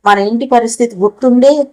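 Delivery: ordinary delivery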